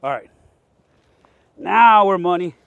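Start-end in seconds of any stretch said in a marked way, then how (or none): 1.6-2.6 loud happy call; excited exclaim. Real, not acted. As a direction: cheer it out. Fire lit, exclaims.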